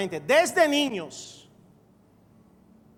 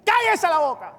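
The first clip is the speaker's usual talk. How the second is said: higher sharp order to be silent; Caleb's command; 7 semitones higher, 5 dB louder than usual. Retold or imitated. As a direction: bark it like a leader silencing a crowd. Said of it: "Caleb los mandó a callarse".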